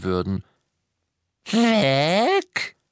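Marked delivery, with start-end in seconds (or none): 1.5-2.8 high, loud croaked question; an elf's anguished single word; a lightly voiced elf saying the locket is gone; croaking elf. A narrator's reading.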